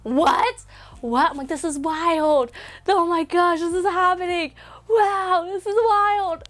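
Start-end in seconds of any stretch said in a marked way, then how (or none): 0.0-1.3 high, stretched disbelief; freaking out. Retold or imitated. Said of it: "freaking out". 1.5-6.4 high squeal of amazement, building; freaking out. Retold or imitated. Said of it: "I'm freaking out the entire time".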